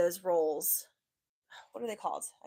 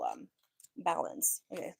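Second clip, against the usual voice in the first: usual speaking voice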